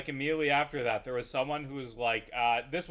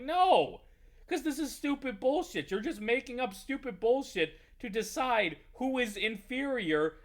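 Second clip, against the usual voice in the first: heated retort; 10 semitones higher than usual. Retold or imitated. Retelling own heated reply.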